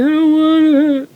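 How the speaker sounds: high, loud protest; high, loud I don't want it; refusing. Retold or imitated. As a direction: cry out a refusal to the nurses. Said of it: "I was like".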